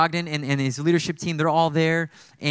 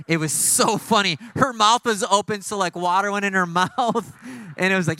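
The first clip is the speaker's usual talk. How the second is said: higher voice breaking into laughter; laughing while telling; 4 semitones higher than usual. Real, not acted. Preacher recalling a teammate soaked by dirty water, laughing. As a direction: tell it through a laugh, pitched up.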